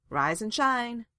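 loud, high cheerful wake-up call; bright, cheery. A narrator's reading. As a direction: sing out brightly to rouse a sleeper. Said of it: "Varric said brightly".

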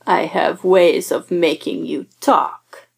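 slow growled bad Russian accent; hammy villain Russian accent. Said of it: "growls in an atrocious Russian accent".

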